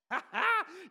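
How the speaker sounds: high, loud burst of laughter. Real, not acted. Preacher laughing at fallen angels' defeat. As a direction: laugh out at the demons' doom. Laugh after doom line.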